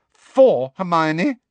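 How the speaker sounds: Ginny correcting Hermione, scowling. A narrator's reading. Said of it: "said Ginny, scowling".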